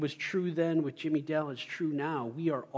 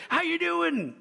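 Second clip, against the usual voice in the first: high booming phone greeting; phone yell; 13 semitones higher, 4 dB louder than usual. Retold or imitated. Pastor Mister Ricky yelling a greeting down the phone, hammed up. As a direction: belt it like a big personality on the phone. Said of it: "he kinda yells when he's on the phone".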